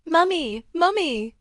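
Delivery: put-on high, sweet little girl calling; childish voice. A narrator's reading. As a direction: call out like a small child. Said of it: "Her childish milky voice".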